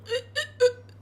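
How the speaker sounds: acted throat gurgles; short choked dying grunts; choking on blood. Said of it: "it just starts cascading blood".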